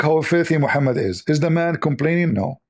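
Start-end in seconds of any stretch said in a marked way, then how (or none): none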